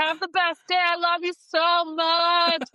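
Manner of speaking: high, loud, cheery parting shout; dad calling out. Retold or imitated. Dad embarrassing daughter at school drop-off, loud cheery shout. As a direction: yell it gleefully across the lot. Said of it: "and he'd be like".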